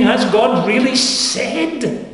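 Satan's question slowed, "really" stretched and pointed; emphasised quote. Shown as a demonstration. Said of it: "Notice the emphasis on the word".

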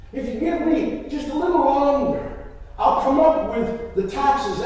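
debtor's imploring promise; pleading. Acted. Blind debtor begs for more time.

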